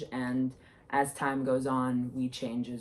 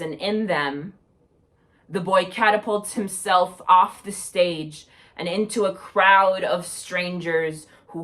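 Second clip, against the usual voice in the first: projected spoken-word recital, louder than chat; poetry reading; 2 semitones higher, 6 dB louder than usual. Acted. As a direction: perform a narrative poem for an audience. Reads second poem aloud.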